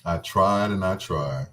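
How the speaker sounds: normal talking voice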